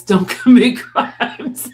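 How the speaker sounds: laughing it out slow and loud. Real, not acted. Giving viewers her advice through laughter. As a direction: crack up while giving the advice.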